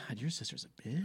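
hushed aside; whispering. Real, not acted. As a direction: whisper it behind her back. Aside about the sister.

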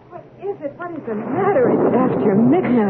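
high, startled questions. Acted. Nurse answers the midnight cries, high and startled. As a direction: jolt awake and ask what's wrong.

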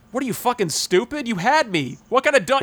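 high mock incredulous scorn; Ford Fairlane. Ford to the disarmed assassin, high imitated scorn. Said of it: "And Ford Fairlane goes".

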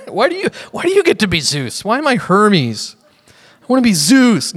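put-on high, childish complaint; hammed-up petulant protest; mock jealous squabble. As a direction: play a sulky apostle protesting. Paul imagined squabbling over being Zeus.

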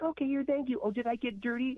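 high, breathy-free valley girl put-on; mock a fake-caring Instagram model; fake influencer voice. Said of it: "Instagram models that might have had the last name Kardashian".

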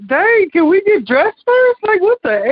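high, incredulous protest; exasperated. Retold or imitated. Guest complaining about being rushed out, retold exasperation.

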